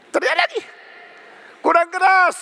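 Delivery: high, squealed mocking call; jeering taunt. Elias taunting Baal's prophets, high jeering cry. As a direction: jeer it out high and piercing.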